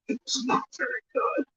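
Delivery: a hushed letdown whisper; whispered aside. Real, not acted. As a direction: whisper the verdict like a secret confession. Aside about disappointing cake.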